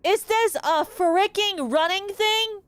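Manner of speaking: screamed way up high, stretched out in fury; frustrated screaming. Real, not acted. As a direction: howl it out at the game, beside yourself. Raging at a running puzzle.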